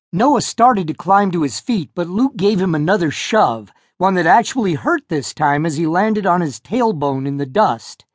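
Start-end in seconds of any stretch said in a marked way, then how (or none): none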